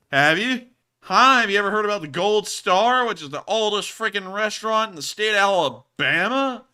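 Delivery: loud, high teasing challenge; excited challenge. Real, not acted. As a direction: taunt him playfully. Teasing challenge, much louder.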